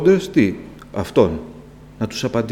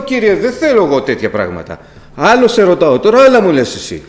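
high, loud exasperated complaint; retold quarrel with God; frustrated protest; 8 semitones higher, 7 dB louder than usual. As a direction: argue that God is not listening. Retold arguing back with God.

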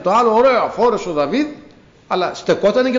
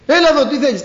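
Christ calls loudly to the blind man, voice raised high; calling out; 8 semitones higher, 6 dB louder, faster than usual. Retold or imitated. Christ calling the blind man over to him, loud call. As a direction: shout it across the crowd, beckoning. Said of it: "Φωνάζει ο Χριστός".

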